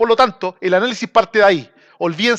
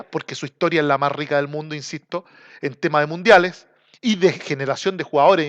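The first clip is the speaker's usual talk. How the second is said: usual speaking voice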